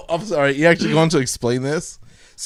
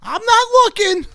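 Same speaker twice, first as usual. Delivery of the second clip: "I'm not looking" in a very high singsong; sing-songy; 4 dB louder than usual. A narrator's reading. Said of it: "she said in a sort of sing-songy way".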